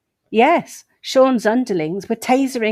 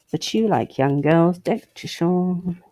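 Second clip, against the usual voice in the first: mocking whisper. Real, not acted. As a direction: whisper it like a knowing jab. Mocking aside to Sean in article.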